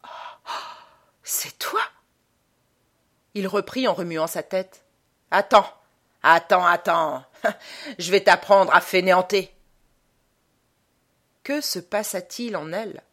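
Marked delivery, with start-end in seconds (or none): 0.0-1.8 breath of shock, then high, hushed question; gasped, barely voiced recognition; stunned murmur. A narrator's reading. 5.3-9.5 loud, menacing scolding; bullying husband's voice from a parrot; threatening parrot. A narrator's reading. Parrot mimicking the angry dead husband.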